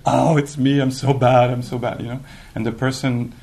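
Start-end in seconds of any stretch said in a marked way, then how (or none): 0.1-2.0 self-pitying wail. Retold or imitated. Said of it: "then I was like".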